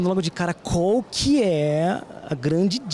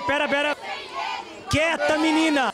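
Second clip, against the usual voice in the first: hushing call; 5 semitones higher than usual. Real, not acted. Host hushing the singing fan.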